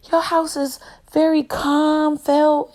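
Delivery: lightly voiced admiring guest; admiring visitor. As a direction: draw out the compliment like a visitor. Quoting visitors praising the house.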